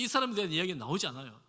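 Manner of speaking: ordinary delivery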